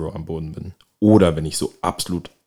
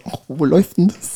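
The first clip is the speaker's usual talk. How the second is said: high, loud mom voice; imitating mom; 11 semitones higher, 7 dB louder than usual. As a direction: play the curious mother high. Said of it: "Sie hat ... so".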